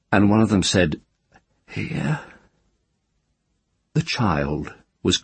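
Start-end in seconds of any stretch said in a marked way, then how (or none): 1.7-2.2 faint whisper. Acted. The child's held faint whisper.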